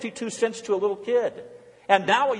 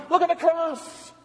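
fervent cry; 10 semitones higher than usual. Acted. Preacher's rising refrain.

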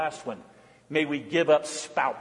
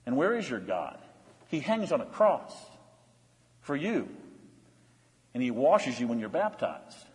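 hushed, tender preaching; 5 semitones lower, 6 dB quieter than usual. Acted.

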